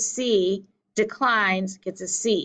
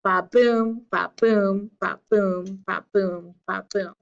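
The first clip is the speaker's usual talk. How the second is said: ba-BOOM five times like a steady drum; iambic beat. Shown as a demonstration. Teacher demonstrating five iambs in a row, ba-BOOM beat. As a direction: punch every "boom", keep each "ba" short. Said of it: "you get the second syllable, the beat".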